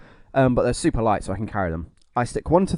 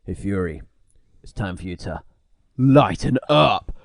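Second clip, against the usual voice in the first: action hero one-liner; 3 semitones lower, 3 dB louder than usual. Retold or imitated. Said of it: "I'm just like".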